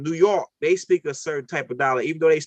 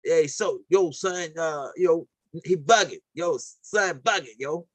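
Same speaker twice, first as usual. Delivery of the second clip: New York slang; slower than usual. Retold or imitated. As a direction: talk like a guy from New York. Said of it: "they got a different dialect in New York".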